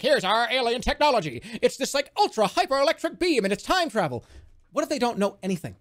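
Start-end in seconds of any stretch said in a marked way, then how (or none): 0.0-4.2 higher pitched, showy alien pitching its tech; mock-impressive alien; grand alien boast. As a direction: hype up the alien gadgets. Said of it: "they're gonna be like".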